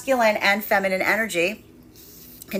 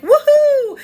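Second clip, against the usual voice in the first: much higher, louder cheer; mock gleeful whoop; 9 dB louder than usual. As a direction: whoop like a giddy past self. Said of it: "past version of me would have been like".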